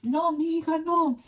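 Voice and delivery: high, loud frantic cry in Spanish; mom screaming. Retold or imitated. Mom screams in Spanish at her bleeding daughter. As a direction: scream it like a panicked mother. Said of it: "My mom kept screaming".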